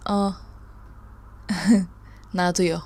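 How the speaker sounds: ordinary talk, nothing marked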